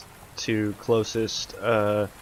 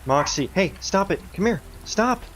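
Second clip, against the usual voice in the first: sharp commands to dog; 7 semitones higher than usual. Real, not acted. Owner to barking dog Moxie, sharp high commands. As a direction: scold the dog firmly to stop.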